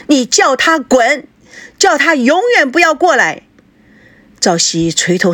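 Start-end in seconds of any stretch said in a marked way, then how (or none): 0.1-3.4 大声喊. A narrator's reading. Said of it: "她在房里面大声地喊道". (在房里大声喊)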